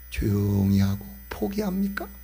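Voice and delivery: ordinary delivery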